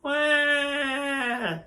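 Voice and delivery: long high-pitched "wah"; mock crying wail. Shown as a demonstration. Teacher showing literal crying out loud, mock wail. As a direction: bawl like a cartoon baby. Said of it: "crying out loud literally is like".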